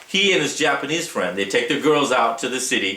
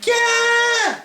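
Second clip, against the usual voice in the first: retold scream. Girlfriend shrieking in the crowded club, a high startled yell. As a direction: mimic the girlfriend's startled shriek as she's grabbed. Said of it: "scream out".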